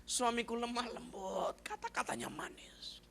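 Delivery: imitated weeping prayer, slow; tearful prayer. Scolded wife praying while crying, imitated tearful voice. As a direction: draw it out, fading to a tearful whisper. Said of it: "Nangis, dia bilang".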